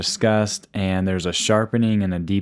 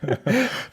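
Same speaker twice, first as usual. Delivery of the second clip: high giggle. Real, not acted. Laughing at the dessert joke.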